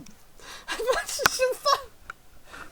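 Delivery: high, wheezing laugh; completely broken up laughing; helpless laughter. Real, not acted. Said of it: "He broke me".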